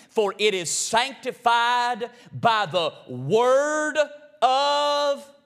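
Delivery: slow, loud, drawn-out Bible reading; slow scripture reading. Acted. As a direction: lay each word of the verse down slowly and loudly. Reads scripture from the pulpit.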